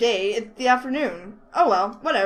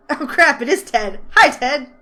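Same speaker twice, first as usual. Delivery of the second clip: louder, higher startled hello; surprised loud greeting; 4 semitones higher, 8 dB louder than usual. Acted. Nicole startled to spot Ted, loud greeting.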